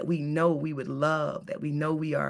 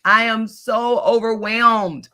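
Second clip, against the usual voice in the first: overwhelmed; 4 semitones higher, 6 dB louder, slower than usual. Retold or imitated. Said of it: "Another one that was coming up".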